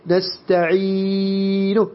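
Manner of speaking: نستعين with the final vowel dragged out very long; wrong long ending. Shown as a demonstration. Teacher warning students with the wrong long ending on نستعين. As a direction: overstretch the end of نستعين as the mistake. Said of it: "خطأ، لا يصح".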